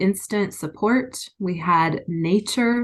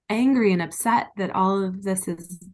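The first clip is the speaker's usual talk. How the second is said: ordinary talk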